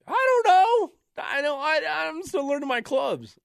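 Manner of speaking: high, loud sheepish excuse; defensive call. Retold or imitated. Beginner calling back an excuse to his friend. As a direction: yell your excuse back, embarrassed.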